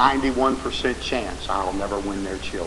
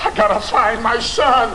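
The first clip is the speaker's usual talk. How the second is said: desperate father; 10 semitones higher, 6 dB louder than usual. Acted. Father frantic to find son.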